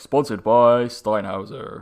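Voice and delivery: slow announcer-style ad read; American advert voice. Retold or imitated. Mocking a UFC sponsor spot, slow American announcer. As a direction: drawl it like a fight-night sponsor plug. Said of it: "little American thing where it's like".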